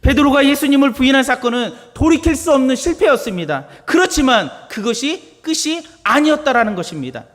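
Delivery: loud, high-pitched preaching; fiery pulpit delivery; preaching at full force. Acted. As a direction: proclaim it to the congregation.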